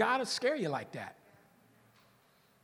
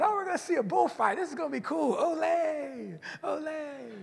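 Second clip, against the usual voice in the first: mock excited kids; 6 semitones higher than usual. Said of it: "We thought".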